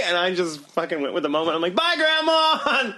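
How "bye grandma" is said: high, drawn-out goodbye holler; played-along farewell call. Young man playing grandson to a stranger, hollered mock farewell. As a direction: sing it out across a store.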